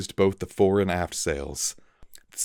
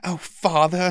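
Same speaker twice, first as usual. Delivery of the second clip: a touch of whimpering, higher; mild whimper; 11 semitones higher, 5 dB louder than usual. A narrator's reading. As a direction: whimper to an absent father. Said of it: "he whimpered".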